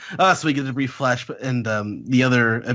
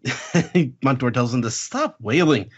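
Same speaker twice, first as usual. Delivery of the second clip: usual speaking voice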